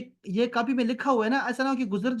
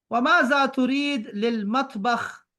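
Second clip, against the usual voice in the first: slow Arabic reading; 3 semitones higher, 3 dB louder, much slower than usual. Shown as a demonstration. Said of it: "teacher reads Arabic line slowly".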